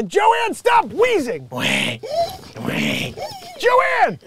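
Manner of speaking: loud, high call across the room. Real, not acted. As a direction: call out to someone nearby, laughing. Calling out to Joanne.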